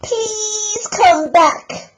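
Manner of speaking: very high, slow, crying plea; snuffled. Acted. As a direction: beg her tearfully to stay. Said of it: "they snuffled".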